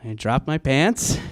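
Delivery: ordinary talk, nothing marked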